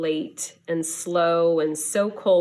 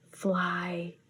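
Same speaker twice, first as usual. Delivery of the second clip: softer, hushed and drowsy; soft whisper; 6 dB quieter than usual. A narrator's reading. Penguin drifting off to sleep, hushed and soft. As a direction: breathe the word as you drift off. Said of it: "Opus whispered to himself".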